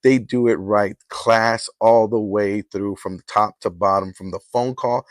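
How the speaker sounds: host reading a sponsor ad, smooth and soft. Acted. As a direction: sell the caterer like a radio spot. Sponsor ad read.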